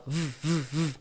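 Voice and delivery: V as a short hum on the lip, no vowel; buzzing V. Shown as a demonstration. Teacher isolates the vibrating sound, voiced V. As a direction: buzz V like a vibrating phone. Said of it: "esse é o som dele".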